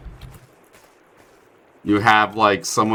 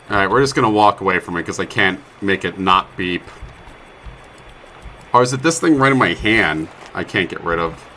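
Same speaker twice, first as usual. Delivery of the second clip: ordinary talk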